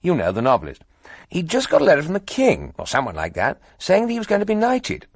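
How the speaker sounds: high, loud and bubbly; eager young toff. A narrator's reading. Cheerful young reporter telling two editors gossip, gushing. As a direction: rattle on brightly, pleased with the news.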